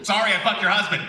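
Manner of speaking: same line in a lightly put-on cartoon character voice, brisk; Blitz. Shown as a demonstration. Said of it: "That's Blitz".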